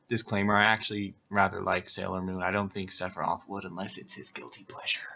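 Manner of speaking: low, hushed aside dropping to a whisper; muttered disclaimer. Real, not acted. Reader confides a disclaimer about Sailor Moon, muttered aside.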